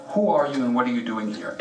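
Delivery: normal talking voice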